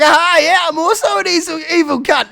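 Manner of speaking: high, loud villain glee; a hammed-up evil gloat; gleeful gloat. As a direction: crow over the trapped dragon. Cat gloats at trap.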